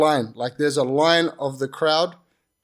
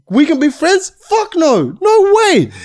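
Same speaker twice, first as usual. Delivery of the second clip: much higher, loud furious yell; loud mocking outrage, fuck no; angry shout; 12 semitones higher, 9 dB louder, slower than usual. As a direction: shout him down in disgust. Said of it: "angry refusal to friendship".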